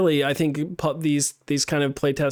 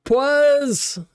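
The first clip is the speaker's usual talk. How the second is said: sudden high, loud shout of a name; a cheerful high greeting burst; excited call-out; 12 semitones higher, 5 dB louder than usual. Real, not acted. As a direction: hail a friend you nearly forgot. Suddenly calling out a viewer.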